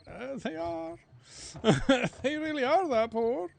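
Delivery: put-on high, loud squeaky boy insisting; schoolboy insisting his parents are poor; childish reply. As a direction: plead it, laughing, like a kid. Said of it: "And I was like".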